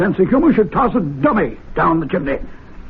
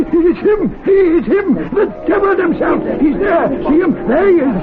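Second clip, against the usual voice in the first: high, breathless cries of alarm; excited shouting; 7 semitones higher than usual. Acted. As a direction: point and shout at the fire in disbelief. Seeing the devil in fireplace.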